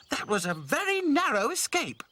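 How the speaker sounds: high breathless doll. Acted. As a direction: squeak it out relieved. Dotty after escape.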